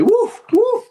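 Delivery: sudden high yapping woof woof; a loud yelped imitation bark; mock dog bark. Imitates a dog mid-story.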